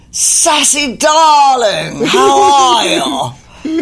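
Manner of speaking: booming, slow, drawn-out greeting, pitched high. Retold or imitated. Singer greeting her unexpectedly, loud effusive imitation. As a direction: hail a darling grandly, stretching every word. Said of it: "unmistakable voice going".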